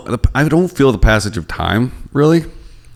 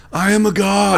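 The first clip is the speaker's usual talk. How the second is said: cocky god voice; 11 semitones higher than usual. Retold or imitated. Bragging about a weekend high.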